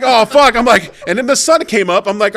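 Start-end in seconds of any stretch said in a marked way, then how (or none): 0.0-0.6 loud, high mock-dismayed cry; retold dismayed outburst. Storyteller reliving an awkward dream for friends, mock dismay. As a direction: blurt it out high and loud, hamming it up.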